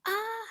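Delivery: sudden high scream of fright; short frightened yelp. A narrator's reading. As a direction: let out a startled cry. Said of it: "Aditi चिल्लाते हुए क-, कहती है".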